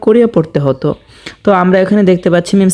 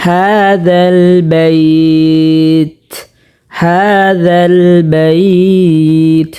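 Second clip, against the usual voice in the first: very slow, drawn-out tilawat chant; slow melodic Quranic recitation; much slower than usual. Shown as a demonstration. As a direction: recite each word with long held vowels. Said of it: "রাব্বা পড়লে শুদ্ধ হবে না".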